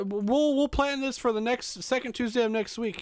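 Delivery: very high put-on voice; joking relative, imitated. Acts out a silly family joke.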